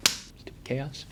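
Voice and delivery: under-the-breath whisper at the mess; whispered grumble. Real, not acted. Host interrupted by chaos, a whispered annoyed aside. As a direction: mutter it to yourself, barely audible.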